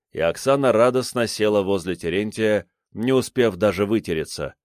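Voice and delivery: ordinary delivery, nothing marked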